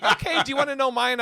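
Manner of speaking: put-on high, laughing girlish voice; a playful girlfriend, lightly voiced; mock girlfriend. Imitating the girlfriend offering her number, high and laughing. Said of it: "she's like".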